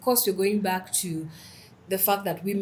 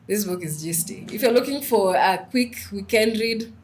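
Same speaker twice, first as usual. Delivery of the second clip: ordinary talk, nothing marked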